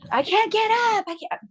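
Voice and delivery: high, pleading I can't; retelling a patient refusing to stand, high and pleading; whiny patient. Retold or imitated. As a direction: whine it like a stubborn patient. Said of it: "patient voiced in story".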